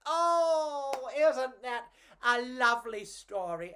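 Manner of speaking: ordinary delivery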